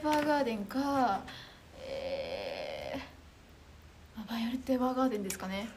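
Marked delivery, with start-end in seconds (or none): 1.8-4.2 long, very low, soft "ehh"; pondering hum. Real, not acted. Streamer choosing her saddest anime, drawn-out pondering. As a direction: drop right down and hold the hesitation.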